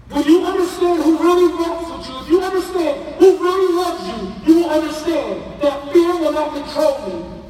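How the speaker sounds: loud, high, driving sermon climax; preaching at full force. Real, not acted. Pastor preaching to the youth about fear, loud and soaring. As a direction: build it up like a pulpit peak.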